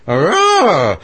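high answering howl; wolf howl imitation. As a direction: howl back like a second wolf. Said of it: "the other one goes".

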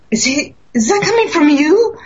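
can't believe the rock is talking; amazed question. Retold or imitated. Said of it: "I go".

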